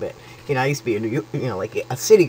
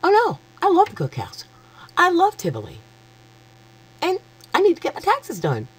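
much higher, almost squealing with delight; squealing excitement. Real, not acted. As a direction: squeal over every perk. Gushing sales pitch to viewers.

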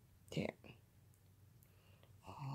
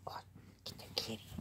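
ordinary talk, nothing marked

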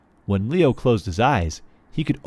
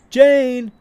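loud, high call across the house; calling from another room; 12 semitones higher, 7 dB louder than usual. Acted. Mom calling Jane to dinner from another room. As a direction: shout her name from the kitchen. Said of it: "a woman's voice came from another room".

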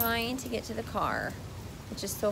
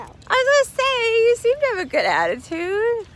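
much higher, louder mock-sweet voice; sarcastic sing-song; 13 semitones higher, 11 dB louder than usual. Real, not acted. Mom teasing grumpy girls with sarcastic high sweetness. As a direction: tease them in a sugary put-on voice.

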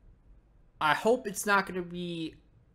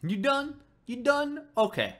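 sarcastic; 5 semitones higher than usual. Real, not acted. Sarcastic to ranting character.

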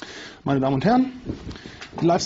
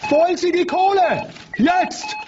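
high, hyped countdown shouts; frantic game show hype; hyped shouting; 7 semitones higher, slower than usual. Acted. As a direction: whip the callers up, shouting. Host hypes callers, shouting.

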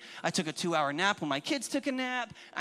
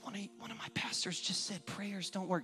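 soft, near-whispered aside; hushed mock shock; 3 semitones lower, 8 dB quieter than usual. Congregation shocked at their pastor's words, hushed murmur. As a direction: drop right down as if scandalized.

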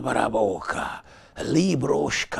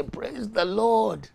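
high, slow put-on praise; forced worship leader; 10 semitones higher, slower than usual. Worship leader forcing praise while hurting inside. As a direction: sing out a dutiful praise. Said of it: "you have to stand there and say".

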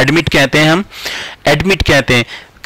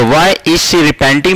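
slow model question; much slower than usual. Shown as a demonstration. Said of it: "teacher models question slowly".

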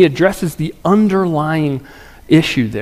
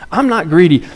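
usual speaking voice

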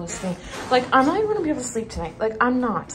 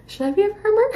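playful joke question; 10 semitones higher, 4 dB louder, faster than usual. Real, not acted.

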